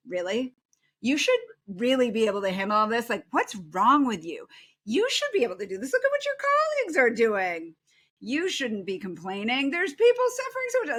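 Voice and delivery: snide friend rising to an outraged peak; mock scolding. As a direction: berate a struggling friend, hammed-up.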